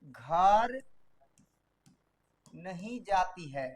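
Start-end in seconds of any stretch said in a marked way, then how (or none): 2.5-3.7 slow, spelled-out dictation pace. Shown as a demonstration. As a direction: read it slowly for students to write. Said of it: "practice के लिए sentence लेते हैं".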